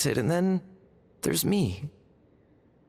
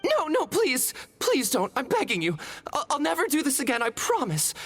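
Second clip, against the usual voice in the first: higher, pleading in a panic; desperate begging; 9 semitones higher than usual. Acted. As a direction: plead fast and scared. Boy begs not to be petrified.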